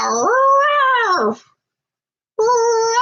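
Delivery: high, strained old-dog bark imitation; mock bark of a sixteen-year-old dog. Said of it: "she like".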